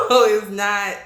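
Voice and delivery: normal talking voice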